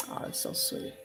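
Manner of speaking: breathy whisper choking up; a hushed voice as tears come; whispered, near tears. Real, not acted. Alone on camera, remembering mom, near tears. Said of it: "I'm gonna start to cry right now".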